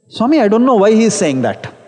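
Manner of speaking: ordinary delivery, nothing marked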